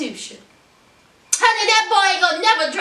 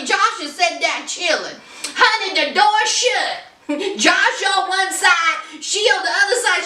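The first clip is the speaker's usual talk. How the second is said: ordinary talk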